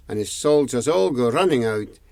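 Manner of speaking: usual speaking voice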